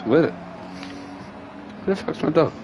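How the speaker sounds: normal talking voice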